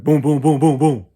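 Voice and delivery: loud pounding knock sounds, boom boom; hard police knocking, spoken; imitated police knock. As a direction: bang out the police knock with your voice. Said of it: "it wasn't like no regular knock".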